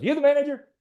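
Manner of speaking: imitating an irate driver demanding the manager; angry demand. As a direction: storm up to the manager and challenge her. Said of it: "he came in cussing".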